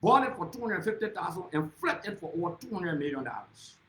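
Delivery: ordinary delivery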